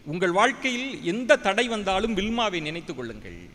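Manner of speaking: ordinary talk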